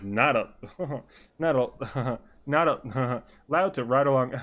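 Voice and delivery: chuckles breaking up the line; acted laughing. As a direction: force little laughs between the words. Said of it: "Am I laughing?".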